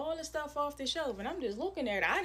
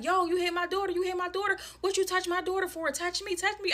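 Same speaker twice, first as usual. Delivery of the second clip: high, raised-voice mock challenge; imitated confrontational mother; 9 semitones higher, 4 dB louder than usual. Girl's mom confronting the woman over her daughter, mock fury. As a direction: act out an outraged parent squaring up.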